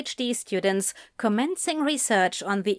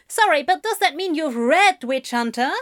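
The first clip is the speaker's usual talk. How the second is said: higher-pitched journalist cutting in; voiced loudly; 6 semitones higher, 3 dB louder than usual. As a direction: raise the voice a little to interject. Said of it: "the journalist voiced loudly".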